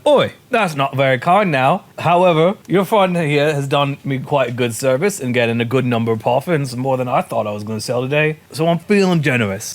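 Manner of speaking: chiding salesman in mock Scottish; vendor's accent. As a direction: scold mildly, then relent, loosely Scottish. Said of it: "he grabbed your arm and he went".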